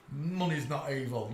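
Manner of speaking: ordinary talk, nothing marked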